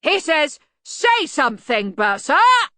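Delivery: high, loud relayed shout; helpful yell. A narrator's reading. Arch-Chancellor relaying the request to the bursar, yelling. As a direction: bellow it as if into a deaf ear. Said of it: "yelled Ridcully helpfully".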